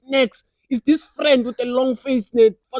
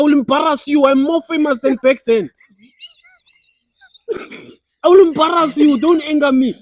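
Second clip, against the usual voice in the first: loud, raised-pitch tirade at an ex; angry rant; 5 semitones higher, 7 dB louder than usual. Acted. Scorned man addressing his cheating ex, loud angry rant. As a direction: let rip, threatening to embarrass her.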